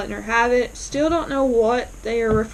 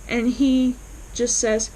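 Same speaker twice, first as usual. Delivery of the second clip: usual speaking voice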